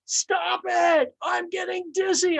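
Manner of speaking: high, loud scream; the teacup screaming at the master to stop the wheel. Acted. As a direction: scream it, dizzy and desperate. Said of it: "I screamed".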